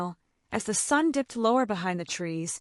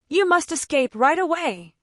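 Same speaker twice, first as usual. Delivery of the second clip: a lightly raised, higher urgent cry; mild narrated alarm, a touch louder and higher; 6 semitones higher, 5 dB louder than usual. A narrator's reading. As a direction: call out the warning in alarm. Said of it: "cried the cat".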